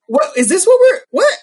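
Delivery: high, loud incredulous questions; baffled, rising outburst; retold disbelief. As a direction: react in mock shock, pitch leaping up. Retells own disbelief at racist takes.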